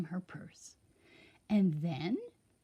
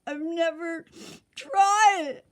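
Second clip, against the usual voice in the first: high, loud crying break on tried; tearful king; 8 semitones higher, 7 dB louder than usual. A narrator's reading. The king crying to Sunshine, high loud sob. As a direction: let the voice crack into a cry.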